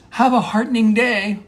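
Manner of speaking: high, bright mock send-off; rousing farewell. Monologist trying out a new goodbye, rousing and high.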